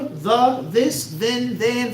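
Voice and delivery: "the, this, then" each said with a clear th; proper enunciation. Shown as a demonstration. Speaker showing proper words within urban slang, carefully enunciated. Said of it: "as long as it's proper".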